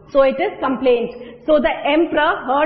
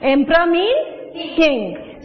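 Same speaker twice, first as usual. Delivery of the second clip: emperor means king, said slowly; slow explaining; slower than usual. Shown as a demonstration. Teacher slowly defining a word for the class. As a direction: spell out the meaning word by word.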